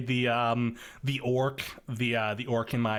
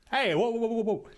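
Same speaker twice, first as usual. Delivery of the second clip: mock alarm; 10 semitones higher, faster than usual. Real, not acted. Mock alarm defending candles.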